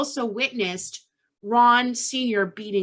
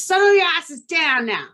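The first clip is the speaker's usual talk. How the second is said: mock mom yell, higher and louder; a mom yelling down from the basement; retold yelling mom; 6 semitones higher, 4 dB louder than usual. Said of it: "Louise starts screaming at the kids".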